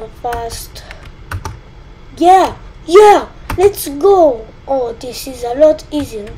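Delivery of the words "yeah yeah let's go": loud, high burst of joy; excited cheer. Real, not acted. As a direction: punch it out like you just won. Cheers in game.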